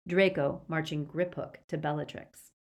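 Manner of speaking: ordinary delivery, nothing marked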